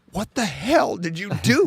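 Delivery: a tearful, stunned biker; overwhelmed crying. Retold or imitated. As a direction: cry it out, blown away. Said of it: "he started crying".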